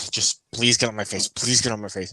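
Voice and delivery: ordinary talk, nothing marked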